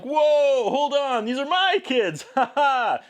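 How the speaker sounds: a high, playful impression ending in a forced laugh; mock Donovan. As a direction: play the flustered guardian laying claim to the kids. Recapping Donovan's protective outburst.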